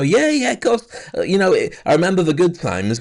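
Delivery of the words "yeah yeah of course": forced bright high yeah yeah; putting on a brave face with an ex, higher and bright. Retold or imitated. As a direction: fake cheerful agreement, pitched high. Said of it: "put on a brave face and go".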